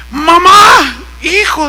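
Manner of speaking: high, loud cry of reunion; acted calling out. Acts reunion of son and mother. (grito agudo de reencuentro, imitado)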